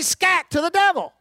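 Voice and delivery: commanding shout of scat; firm scat. Shown as a demonstration. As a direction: bark it out with authority. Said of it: "rise up and say".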